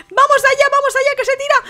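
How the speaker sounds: excited cheering. Real, not acted. Last contestant jumps.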